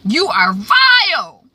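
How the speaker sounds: hammed-up furious accusation; outraged daughter. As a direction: spit out the contempt. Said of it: "That's what she says to her mother".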